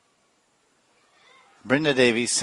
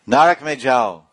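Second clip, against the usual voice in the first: Hindi shout; 4 semitones higher, 8 dB louder than usual. Retold or imitated. Hindi scolding retried.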